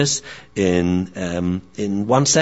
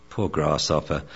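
lower, softer consoling sage; wise old dog, sympathetic; 5 semitones lower, 7 dB quieter than usual. Acted. Theo consoles the young dog, lower and softer. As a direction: sigh it out like a gentle old master. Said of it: "Theo the Akita".

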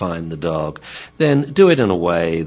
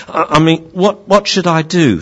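higher, louder, stammering plea; flustered dog pupil; 10 semitones higher, 7 dB louder than usual. Acted. Pupil dog asking Sensei what to do, raised flustered voice. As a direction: appeal to the master, voice raised.

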